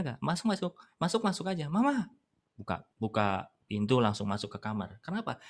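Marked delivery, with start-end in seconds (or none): 0.2-2.1 put-on high-pitched child walking right in; child calling Mama. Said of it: "Kalau Anda sebagai anak". (anak memanggil mama)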